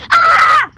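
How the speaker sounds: startled yelp. Real, not acted. Sees evil Sniffles appear.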